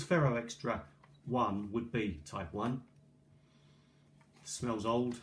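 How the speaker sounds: usual speaking voice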